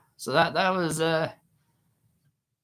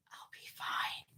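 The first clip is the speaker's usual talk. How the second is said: whisper; 16 dB quieter than usual. Retold or imitated. Friend asks if she's okay.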